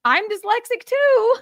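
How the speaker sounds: high, loud, laughing mock exasperation; exasperated French woman. Daughter voices the French woman, laughing and exasperated. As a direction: play a flustered winner cut off mid-speech. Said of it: "the poor French woman is just going".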